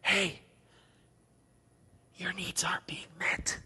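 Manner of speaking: hushed, unvoiced tempter's whisper. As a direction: breathe it like Satan's lie. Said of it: "he would whisper".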